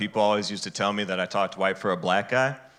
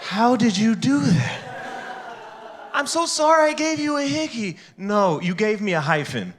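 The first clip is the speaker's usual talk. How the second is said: high put-on girly voice, much higher; 10 semitones higher than usual. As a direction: squeak it like a giggly young woman.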